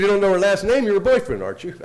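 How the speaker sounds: retold detective's loud, high-pitched challenge; accusing questioning. Detective pressing him in retold questioning.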